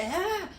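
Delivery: a sudden high yelp of shock; startled yelp. Retold or imitated. Retelling seeing him crunch a carrot, a high startled yelp. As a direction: jump at the sight, yelp. Startled reaction in retold story.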